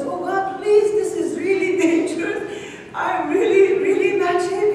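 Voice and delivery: high, pressing plea with a laugh breaking in; stranded climber pleads with God again, high and urgent; desperate pleading. Acted. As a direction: beg harder, voice lifted in alarm. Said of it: "He's really desperate".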